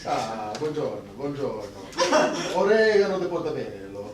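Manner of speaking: put-on Italian gondolier accent.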